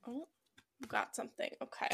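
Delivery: ordinary talk, nothing marked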